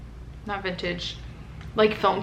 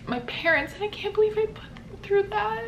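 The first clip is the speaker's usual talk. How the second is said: tearful; 11 semitones higher, 4 dB quieter than usual. Real, not acted. Tearful regret about parents.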